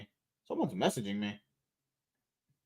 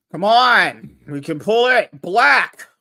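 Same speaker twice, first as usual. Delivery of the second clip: loud urging; 4 semitones higher, 9 dB louder, slower than usual. Real, not acted. Gamer urging team on.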